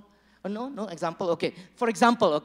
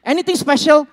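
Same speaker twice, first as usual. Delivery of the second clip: higher, louder wife's question; wife asking; 6 semitones higher, 11 dB louder than usual. Retold or imitated. Pastor voicing a wife asking her husband, higher and louder. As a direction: ask brightly like a wife. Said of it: "You know".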